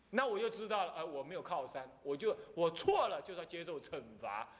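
ordinary talk, nothing marked

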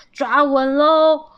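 loud, stretched call-out; calling warning. A narrator's reading. Squirrel dad telling riders to hold tight, calling out.

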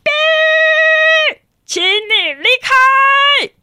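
high, loud, slow command; booming hero voice; commanding shout. Acted. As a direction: order the villain away. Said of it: "听到另外一个声音讲".

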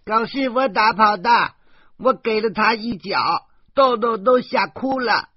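put-on high, loud child's voice, boasting; a small girl bragging she kicked the dog away; proud little girl. A narrator's reading. Little girl boasting to the family, high and loud.

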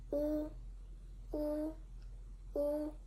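"wu" held high and level; first tone wu. Shown as a demonstration. Teacher modelling the first tone word "wu" for learners. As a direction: sing "wu" on one high steady pitch.

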